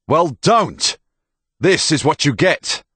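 loud, high, furious rebuke; angry shout. Acted. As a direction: shout them down angrily. Soldier angrily rebukes the girl.

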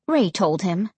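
ordinary delivery

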